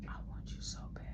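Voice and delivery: soft whisper right into his ear. Retold or imitated. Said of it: "she comes in my ear like".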